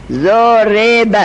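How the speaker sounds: ضرب drawn out slowly as "zo-re-ba"; kasra on ra. Shown as a demonstration. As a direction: spell out the vowels of ضرب, syllable by syllable, "zo-RE-ba". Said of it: "را کسره داره یا نه؟".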